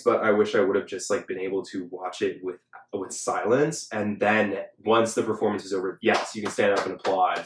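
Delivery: normal talking voice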